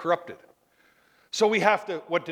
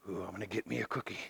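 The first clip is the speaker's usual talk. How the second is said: very soft, low and quick; sneaky under-the-breath scheming; sneaking; 10 semitones lower, 15 dB quieter, faster than usual. Acted. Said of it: "I remember sneaking over there".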